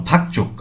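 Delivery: ফাক চোখ joined, with a tense ch; ch for jiut. Shown as a demonstration. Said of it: "উচ্চারণটি হবে চ এর মতো".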